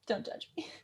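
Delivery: quick hushed plea; whispered aside. Real, not acted. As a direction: whisper it like an embarrassed secret. Whispered aside about her nerdy scarf.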